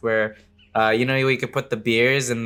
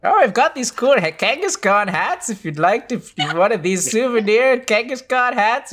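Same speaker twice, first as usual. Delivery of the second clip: higher, excited gift shop seller; eager shopkeeper voice; 8 semitones higher than usual. Acted. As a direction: pitch the souvenirs like a keen salesman. Shopkeeper NPC selling souvenirs.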